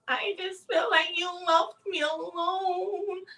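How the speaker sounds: high, drawn-out whiny wail; mock crying. Retelling her crying at her man, mock tearful whine. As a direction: imitate her tearful complaint, stretched out. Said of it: "she's crying and being like".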